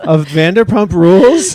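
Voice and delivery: loud, high joking question; amused disbelief. Real, not acted. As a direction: tease her with a silly guess.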